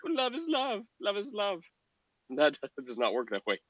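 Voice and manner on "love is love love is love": high mocking put-on voice; mocking slogan. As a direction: parody the slogan higher. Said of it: "and then be like".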